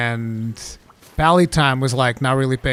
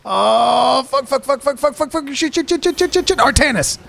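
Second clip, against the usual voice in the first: higher, louder mock panic, climbing; imitating panicked friend; 11 semitones higher, 4 dB louder than usual. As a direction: rattle off the swears like a panicking friend. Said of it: "popping off like".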